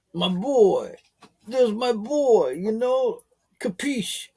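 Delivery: high, slow mock gangster crooning to the dog. Talking to his dog in a gangster voice. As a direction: talk to the dog like an old movie mobster. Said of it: "You know, like the gangster".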